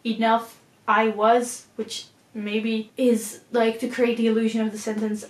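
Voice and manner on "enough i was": "Enough I was" drawn out slowly with a rise; slow stressed reading. Shown as a demonstration. Testing how the line break rereads the poem, slow and deliberate. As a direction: spell out the new phrase slowly, weighing each word. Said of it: "creates the sentence that says".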